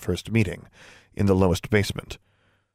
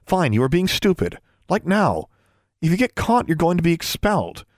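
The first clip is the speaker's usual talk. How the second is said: put-on higher, exasperated young girl; scolding girl; 8 semitones higher than usual. A narrator's reading. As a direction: scold her friend sharply. Darla scolding her friend.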